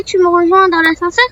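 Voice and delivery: normal talking voice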